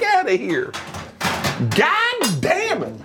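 high, hollering southern grandfather; drawn-out, outraged southern cussing; southern accent. Acted. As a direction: cuss like a scandalized southern grandpa. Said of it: "he has a southern accent".

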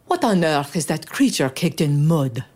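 a touch of an irritated passenger; mildly put-out demand from the carriage; understated indignant passenger; angry demand. Acted. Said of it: "demanded an angry passenger".

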